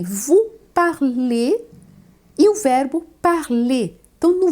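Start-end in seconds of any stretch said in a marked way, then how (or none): 0.0-1.6 vous parlez said very slowly, ending in é; drawn out vous parlez for clarity; slow careful French. Shown as a demonstration. Teacher slowly contrasts vous parlez with the infinitive. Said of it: "Vejam bem".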